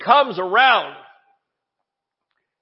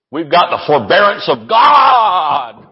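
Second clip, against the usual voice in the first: shouted, high preaching build; fired-up preacher shouting, a touch of Rod Parsley; preaching at full force; 6 semitones higher, 3 dB louder than usual. Real, not acted. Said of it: "I know I'm screaming".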